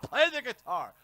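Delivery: high, pushy fan demanding "Play the guitar."; mock demanding fan. Viewer nagging the streamer to play, mock demand.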